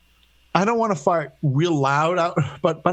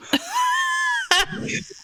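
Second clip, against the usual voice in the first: howling laugh; big shrieking laugh. Real, not acted. Reaction to granddaughter's joke.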